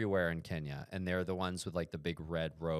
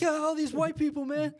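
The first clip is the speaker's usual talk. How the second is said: very high, loud put-on voice; hammed-up fright; 9 dB louder than usual. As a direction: exclaim in exaggerated comic alarm. Voicing imagined frightened tourists.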